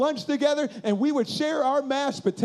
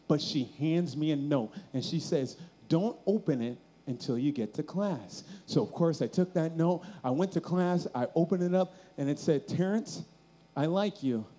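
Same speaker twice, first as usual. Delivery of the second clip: low, soft, heavy-hearted narration; subdued, crushed retelling; somber storytelling; 7 semitones lower, 5 dB quieter than usual. Acted. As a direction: bring it right down for the heartbreak.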